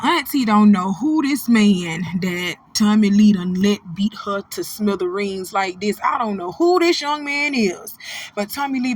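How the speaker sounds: ordinary talk, nothing marked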